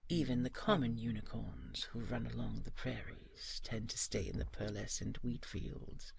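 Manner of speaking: ordinary talk, nothing marked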